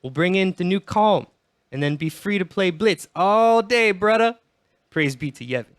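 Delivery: hammed-up fake Jamaican lilt; bad Jamaican accent. Podcaster as Wakka promising the new calm, bad Jamaican accent. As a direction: preach piously in a badly faked Jamaican accent. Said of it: "do a really, really shitty Jamaican accent".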